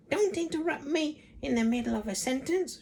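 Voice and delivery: high, shrill-voiced old hag yelling; shouting Grandma. A narrator's reading. Grandma shouts at George for interrupting. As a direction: cut him off like a cranky grandmother. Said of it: "she shouted".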